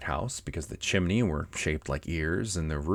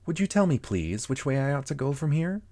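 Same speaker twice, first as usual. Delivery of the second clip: put-on higher-pitched questioning girl character; polite Alice; 7 semitones higher than usual. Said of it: "and she went on".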